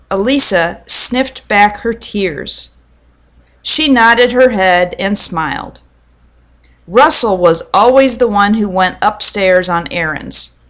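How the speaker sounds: plain reading voice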